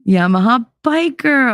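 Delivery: high, delighted call-out of a name; excited greeting. Real, not acted. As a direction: greet a favourite viewer arriving. Greets a viewer on spotting them.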